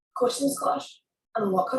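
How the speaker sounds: ordinary talk, nothing marked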